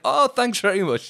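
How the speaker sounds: high, loud fake-bright thanks; sweet as pie, taunting; overly cheerful sarcastic thanks; mock cheery thanks. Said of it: "And I was like".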